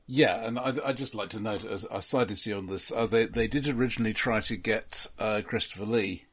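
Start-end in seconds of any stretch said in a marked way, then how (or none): none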